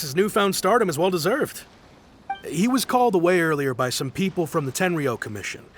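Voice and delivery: ordinary delivery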